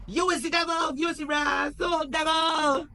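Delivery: high furious mock shout. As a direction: hurl it back at him, loud and high. Michael shouts back.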